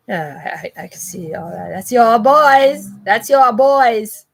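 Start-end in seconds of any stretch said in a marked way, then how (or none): 1.7-4.3 loud, high, drawn-out shout; high-pitched loud taunt; taunting call-out. Acted. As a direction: holler it slowly, stretching the words. Mock cheer at the viewers.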